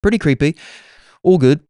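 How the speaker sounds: ordinary talk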